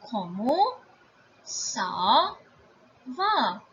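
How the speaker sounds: "Comment ... ça ... va" with gaps; word by word. Shown as a demonstration. Teacher introducing the informal question, word by word. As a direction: set each word apart, drawing it out. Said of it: "informal way of asking".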